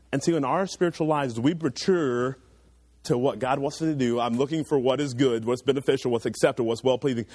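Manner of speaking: ordinary delivery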